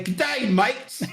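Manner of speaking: a high, hammed-up Australian "G'day, mates"; Australian accent. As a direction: greet them like a stage Aussie. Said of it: "I can't do accents".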